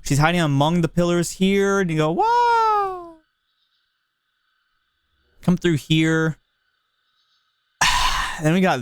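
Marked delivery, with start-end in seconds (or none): 2.1-3.2 a sudden high squeal of fright; mock startled whoa. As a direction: yelp it out like a rider jumping out of their seat. A monster lunges at the rider.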